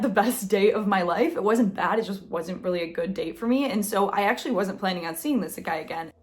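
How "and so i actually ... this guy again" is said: usual speaking voice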